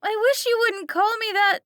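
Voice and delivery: put-on high, loud pleading girl; Kelly crying out. A narrator's reading. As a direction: defend her dad, desperate. Kelly protests.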